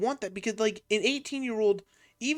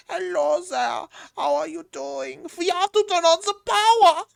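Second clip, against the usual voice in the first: put-on much higher, loud German-accented mad scientist; crazy German scientist; 12 semitones higher, 13 dB louder than usual. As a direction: shout it like a manic German scientist. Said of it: "this crazy German scientist. And he talks like that".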